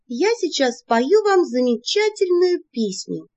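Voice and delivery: high, slow grand announcement; tipsy announcement. A narrator's reading. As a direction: proclaim it, wine in hand. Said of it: "объявила я, размахивая кубком".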